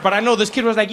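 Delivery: normal talking voice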